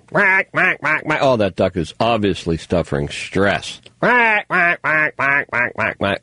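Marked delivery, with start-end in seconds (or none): 0.1-1.2 high, mock duck quacks; duck quacking. Host mocking experts who speak duck, imitation quacking. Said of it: "can they speak duck?". 4.0-6.2 duck quacking. Acted. Host mocking the duck's stress, imitation quacking. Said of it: "can they speak duck?".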